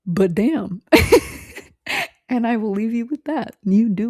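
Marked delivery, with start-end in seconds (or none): 0.9-1.6 high laugh. Real, not acted. Laughs after story.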